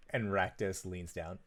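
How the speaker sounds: ordinary talk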